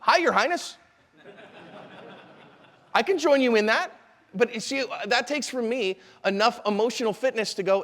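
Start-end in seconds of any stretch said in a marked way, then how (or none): 0.0-3.9 higher, easy humoring voice; officer going along with King Elmer, pitched up; playing along. Shown as a demonstration. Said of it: "Totally fine, right?".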